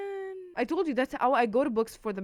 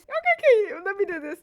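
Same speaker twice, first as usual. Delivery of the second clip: far higher and louder, gushing; giddy excitement; 12 semitones higher, 11 dB louder than usual. Real, not acted. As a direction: gush over the gift. Excited about customized book.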